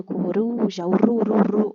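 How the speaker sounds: "Coruja" then "ru" repeated with a tapped R; weak R. Shown as a demonstration. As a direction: isolate the light R of "Coruja" in "ru, ru". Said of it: "R fraco". ("Coruja" destacando o "ru" com R fraco)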